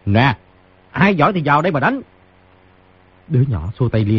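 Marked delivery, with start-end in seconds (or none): 0.1-2.0 pitched up high on the challenge; raised taunting voice. A narrator's reading. Wounded fighter challenging those outside, raised voice. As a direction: call out a high, daring taunt. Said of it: "cao giọng cười nói".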